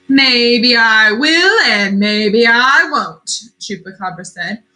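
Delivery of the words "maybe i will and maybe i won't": slow, loud, teasing monster voice. A narrator's reading. Chupacabra toying with the goat, slow loud taunt. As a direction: taunt him slowly.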